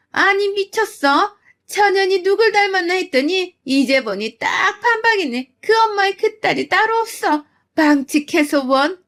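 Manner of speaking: mother-in-law screaming insults, high; shrill in-law. Acted. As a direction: screech back in outrage. Mother-in-law shrieking back.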